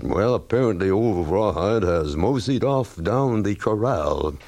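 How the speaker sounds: put-on slow, lazy cowboy accent, only half committed; a hammed-up cowboy accent, a touch slow; half-waxed cowboy accent. Colleague mocking on air, in a half-hearted cowboy accent. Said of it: "put on a half-waxed cowboy accent".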